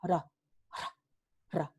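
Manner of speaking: ra ra ra with a devoiced r; r sorda; voiceless tap. Shown as a demonstration. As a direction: whisper the r in ra, no voice. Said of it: "se pronuncia como sorda".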